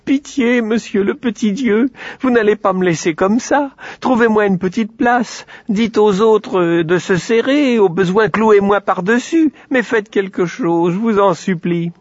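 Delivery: supplique aiguë et pleurnicharde; cochon pleurnicheur. A narrator's reading. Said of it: "se mit à pleurnicher". (petit cochon qui pleurniche et supplie)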